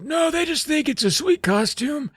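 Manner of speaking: put-on high, strained old man voice. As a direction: croak it out like a frail old man. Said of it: "he's like".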